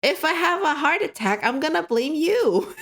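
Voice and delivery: exaggerated pretend distress at a friend; mock panic. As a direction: ham up the dramatic panic, half laughing.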